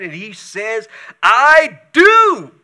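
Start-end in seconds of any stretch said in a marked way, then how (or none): 1.2-2.5 loud, high, laughing yell of I do; a defiant laughing shout. Acted. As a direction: blurt it out like a furious sulking prophet. Jonah answering God defiantly.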